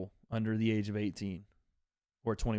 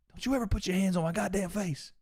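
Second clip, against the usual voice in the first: higher, menacing mock tough-guy line; hammed-up fighter threat, pitched up; imitating Caleb's threat; 8 semitones higher than usual. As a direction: snarl the warning as the boxer did. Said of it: "That's how he said it".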